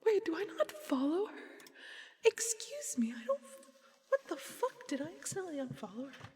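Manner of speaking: high-pitched, incredulous squawk; real disbelief, voice leaping way up; shocked disbelief. Real, not acted. Streamer finding she somehow unfollowed a creator, shocked disbelief.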